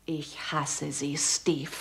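low, soft and slow, hatred held under the breath; quiet hatred. Acted. Gloria quietly tells Steve she hates him, slow and soft. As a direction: let the hate seep out quietly and slowly. Bitter confession to doctor.

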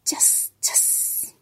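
soft whispered "chas, chas"; hushed voiceless shaker noise; imitated rainstick. A narrator's reading. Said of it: "que hace".

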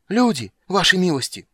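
high, loud, out-of-breath cry; gasping alarm. A narrator's reading. Shoemaker runs up to the lords, panting and shouting. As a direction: shout it while winded from running. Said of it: "задыхался сапожник".

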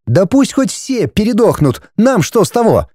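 high-pitched scornful wife; callous dismissal. Acted. Wife dismissing the villagers' deaths, scornful.

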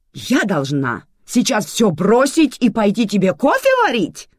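loud, high indignant outburst; angry bark. A narrator's reading. Manana answers Sergo's coffee request, barking. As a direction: bark it back in outrage. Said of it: "рявкнула Манана".

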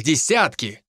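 usual speaking voice

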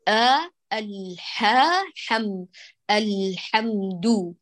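الحمد very slow with the ل and م equally long; deliberate teacher's demo of الحمد; slow model recitation. Shown as a demonstration. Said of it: "ساوي بين زمن اللام والميم".

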